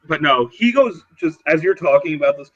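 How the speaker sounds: normal talking voice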